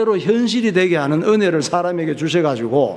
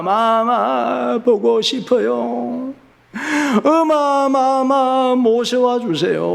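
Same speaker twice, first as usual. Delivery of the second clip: high, slow wailing little princess; crying child; 5 semitones higher, much slower than usual. Acted. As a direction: sob like a child missing her mother. 아기 공주가 엄마 찾으며 울음. (엄마를 찾으며 우는 아기 공주, 흐느끼듯 높고 느리게)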